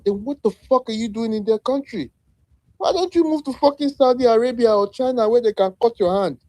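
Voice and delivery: getting angry. Real, not acted. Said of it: "the way I'm getting angry".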